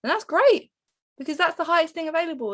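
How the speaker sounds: high, loud gush of delight; excited. Real, not acted. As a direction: burst out with glee. Excited about biscuits.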